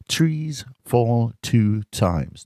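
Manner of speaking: very slow, each word separate; slow and deliberate. Shown as a demonstration. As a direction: stretch "Trees fall two times" out slowly. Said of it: "Listen to the t".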